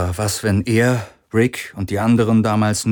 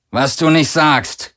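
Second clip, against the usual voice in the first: growled; 6 semitones higher, 3 dB louder, slower than usual. A narrator's reading. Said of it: "knurrte Akina".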